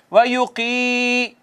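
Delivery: slow, drawn-out chanted recitation; Quran recitation. Shown as a demonstration. Teacher reciting the verse for students, slow chanted recitation. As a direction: recite each word slowly, stretching the vowels. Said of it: "recites part by part".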